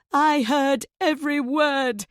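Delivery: high, loud scream of betrayal; wife screaming at her husband. A narrator's reading. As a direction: scream it at him. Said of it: "she screams".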